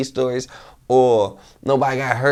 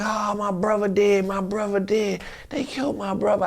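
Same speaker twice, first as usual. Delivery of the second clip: retold screaming wail; 9 semitones higher than usual. Friend retells a grieving passenger in the van, high wailing cry. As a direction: imitate a man howling that his brother is dead. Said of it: "just screaming like".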